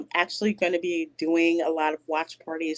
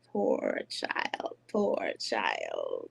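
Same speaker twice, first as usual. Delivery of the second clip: much lower, breathy hiss of a sinister eel; 5 dB quieter, much slower than usual. Retold or imitated. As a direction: hiss it low like a scheming eel. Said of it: "they would hiss and talk to each other".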